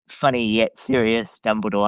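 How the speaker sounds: normal talking voice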